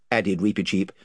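ordinary delivery

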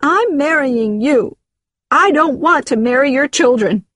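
high-pitched emphatic protest; heated lovers' quarrel; upset outburst. Acted. Crying woman argues with partner.

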